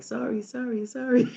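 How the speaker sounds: high, hammed-up sorry to the pumpkin; mock apology. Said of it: "the whole time I was like".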